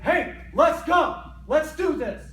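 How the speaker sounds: loud, high, slow hype call; pumped-up encouragement. Retold or imitated. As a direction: fire up a teammate. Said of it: "you're like".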